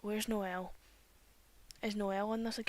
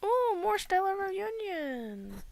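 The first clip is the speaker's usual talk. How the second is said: excited; 9 semitones higher, 4 dB louder, slower than usual. Real, not acted. Spots event on game screen.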